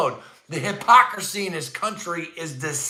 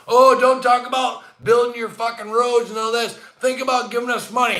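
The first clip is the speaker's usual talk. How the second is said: a hammed-up pleading voice, pushed up into a shout at the end; mock begging; 6 semitones higher, 3 dB louder than usual. Ranting vlogger mocks Zelensky asking for money, a mock begging shout. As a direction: mimic a foreign leader begging for money, half-mocking.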